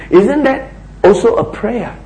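ordinary delivery

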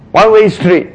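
ordinary talk, nothing marked

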